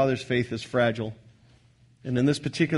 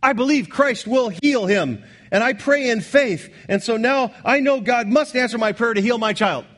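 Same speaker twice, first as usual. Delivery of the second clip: a raised, insistent mock believer claiming God must heal; presumptuous believer; 8 semitones higher, 4 dB louder than usual. Preacher mimics a presumptuous parent demanding healing, insistent mock voice. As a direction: voice the believer who thinks God owes him, pitched up. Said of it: "And you say".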